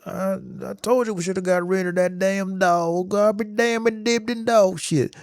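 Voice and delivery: high, complaining grumble about the dog; grumpy foster dad. Retold or imitated. Foster dad griping about the barking dog, mock complaint. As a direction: gripe like a fed-up dad cursing the dog. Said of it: "He's like".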